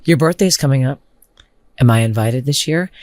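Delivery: a hint of low, creepy doctor. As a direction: imitate a creepy doctor fishing for an invitation. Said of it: "creepy Doctor Brado".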